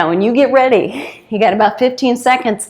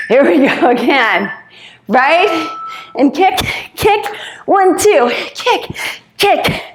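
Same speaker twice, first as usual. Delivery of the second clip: energetic coaching callout; 4 semitones higher, slower than usual. Real, not acted. Coach pushing final interval.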